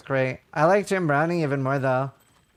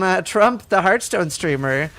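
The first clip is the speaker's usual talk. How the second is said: usual speaking voice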